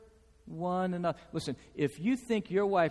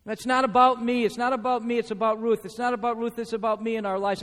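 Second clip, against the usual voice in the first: usual speaking voice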